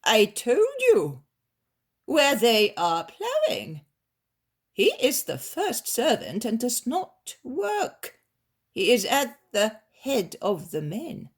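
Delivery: irritated master. Acted.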